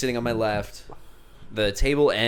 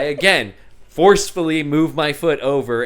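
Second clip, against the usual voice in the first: ordinary talk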